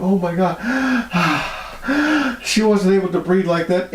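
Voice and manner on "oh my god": imitated high sigh of relief after "Oh my God"; relieved sigh. Healed girl's first easy breath, a relieved high sigh. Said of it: "It was like".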